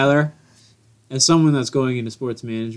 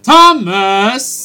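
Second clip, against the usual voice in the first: much higher, louder, slow hype-man call; arena announcer; 9 semitones higher, 8 dB louder than usual. Pretend. Co-host hyping the guest's entrance in a mock announcer voice. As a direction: stretch it out like a boxing ring announcer.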